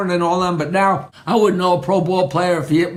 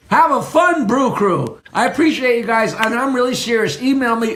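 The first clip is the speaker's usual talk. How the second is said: normal talking voice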